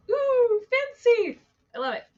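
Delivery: high, loud mock-fancy squeal; mock-impressed. Host reacting to the title Heavens Within Us, mock-impressed. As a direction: coo it way up high, playfully impressed.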